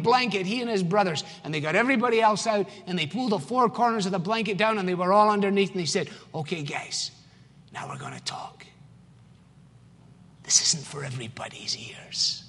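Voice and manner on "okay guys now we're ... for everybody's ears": hushed secret whisper. Retold or imitated. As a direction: drop to a near-silent whisper, much softer. Joseph hushes brothers under blanket.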